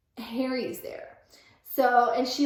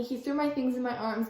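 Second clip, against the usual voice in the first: ordinary talk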